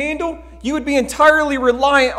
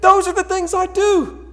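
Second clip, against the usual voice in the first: higher, pleading confession; imitating anguished Paul; 8 semitones higher, 3 dB louder than usual. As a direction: plead like a man who keeps failing. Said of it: "Paul in Romans seven who says".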